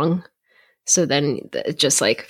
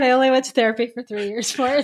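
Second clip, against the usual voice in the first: sarcastic; 6 semitones higher, faster than usual. Real, not acted. Joking about own therapy.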